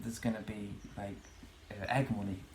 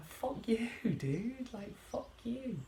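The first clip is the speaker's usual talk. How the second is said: mock defiance; 13 semitones higher, slower than usual. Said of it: "and I think".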